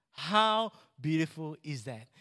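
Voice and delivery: drawn-out HOW, then a pause; slow, deliberate rhetorical question; slow emphatic repeat. Real, not acted. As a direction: savour it slowly, stretching "How".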